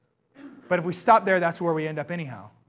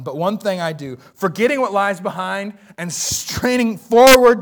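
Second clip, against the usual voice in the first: louder, driving sermon delivery; full-force preaching; 4 semitones higher, 8 dB louder than usual. Acted. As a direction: preach it to the back row.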